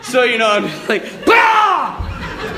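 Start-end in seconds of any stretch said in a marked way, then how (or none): 1.3-2.5 high, loud laughing shout as a wave hits; laughing mock yell. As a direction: yelp it, laughing at the memory. Hit by the next wave.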